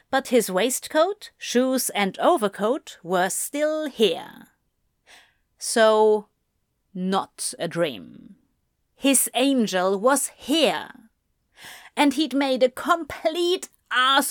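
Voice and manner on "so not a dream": usual speaking voice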